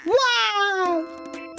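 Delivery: shrill falsetto exclamation; falsetto wow. Pretend. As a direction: squeal it in falsetto.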